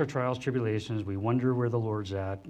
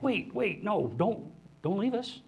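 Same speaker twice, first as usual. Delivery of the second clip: higher, pleading cry of wait; mock panicked plea; 9 semitones higher, slower than usual. Followers begging their departing guide, mock panicked and high. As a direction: beg him not to go, a touch panicked.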